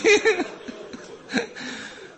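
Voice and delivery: a breathless high-pitched sob; high sobbing wail. Acted. Preacher sobbing after calling Mahdi. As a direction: let a wail break out.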